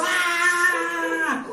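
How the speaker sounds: high, loud scream of a rollercoaster drop; falling-drop shriek. Shown as a demonstration. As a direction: let out a thrill-ride scream. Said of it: "experimentamos ese famoso".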